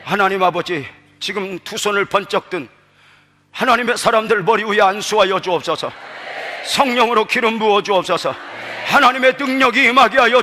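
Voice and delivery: subdued, earnest invocation; prayer. Real, not acted. Begins a prayer.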